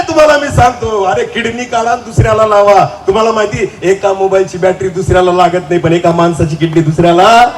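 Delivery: ordinary delivery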